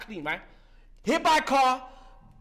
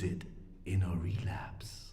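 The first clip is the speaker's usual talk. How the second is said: whispered warning; 12 dB quieter than usual. Real, not acted. Speaker at a recovery meeting warns the room, whispered. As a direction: drop to a hushed whisper.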